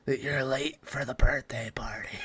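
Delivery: slow, whispered demon impression; hushed, unvoiced-sounding devil rasp; demonic voice. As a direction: whisper it slowly like a movie demon. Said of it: "it's just, like, a demonic voice".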